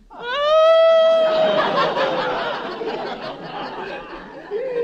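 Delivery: long high loud wail, rising; wife wailing at the threshold; wailing sob. Acted. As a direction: howl through tears. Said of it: "that's funny".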